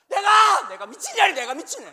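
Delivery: screaming high and loud, losing it completely; furious shout. Retold or imitated. Wife exploding at her husband over nothing, screaming in fury. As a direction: blow up at him like a wife at the end of her rope.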